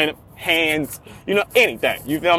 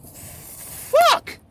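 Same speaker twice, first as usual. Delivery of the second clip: exasperated outburst. Real, not acted. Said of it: "exasperated outburst".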